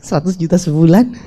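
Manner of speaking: a touch of bashful hesitance; mock-shy, name the figure as if embarrassed. Dreamer shyly naming his income goal, mildly bashful. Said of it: "Sambil malu-malu".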